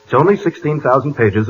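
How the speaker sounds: normal talking voice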